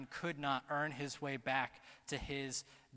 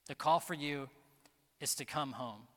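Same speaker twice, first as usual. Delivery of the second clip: usual speaking voice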